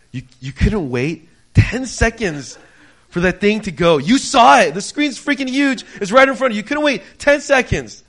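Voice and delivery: loud, high, building exasperated tirade; inward screaming rant. Retold or imitated. Player silently raging at the interrupting employee, loud screaming rant. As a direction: act out a furious inner scream, climbing as it goes. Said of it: "inside my head I'm screaming".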